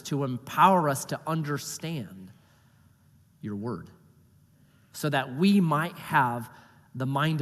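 soft, low closing prayer; hushed pastor's prayer. Acted. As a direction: pray it quietly and reverently. Opening prayer before sermon.

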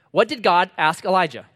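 ordinary talk, nothing marked

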